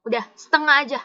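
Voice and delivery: usual speaking voice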